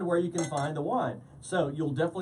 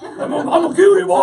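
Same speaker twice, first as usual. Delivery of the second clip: high, loud, fast laughing announcer; network promo voice; 10 semitones higher, 13 dB louder, faster than usual. Pretend. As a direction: hype it up like a wrestling promo. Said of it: "We all have to talk like this".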